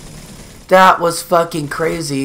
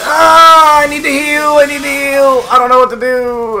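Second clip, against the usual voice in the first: high, loud panicked cry; panicked wailing; 9 semitones higher, 8 dB louder than usual. Real, not acted. Gamer needing to heal mid-fight, panicked wailing. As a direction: wail it out in a panic. Low health in boss fight.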